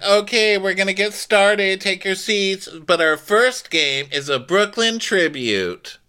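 high, projected announcer-like voice; mock party hostess. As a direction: imitate a hostess starting the games. Kenya hosting the party games.

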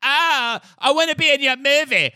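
street hustler. Retold or imitated. Street people voiced.

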